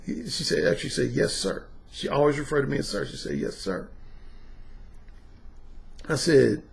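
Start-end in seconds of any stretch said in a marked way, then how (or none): 1.1-1.7 obedient. Retold or imitated. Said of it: "She was obedient". 3.4-3.9 obedient. Retold or imitated. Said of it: "She was obedient".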